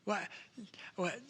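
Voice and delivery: normal talking voice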